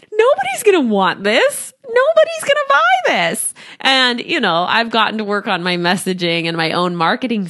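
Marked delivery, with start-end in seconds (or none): 0.1-3.5 high-pitched wail of self-doubt; mock despair. Coach replaying her doubts about selling, mock despair. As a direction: climb much higher, hammed-up worry.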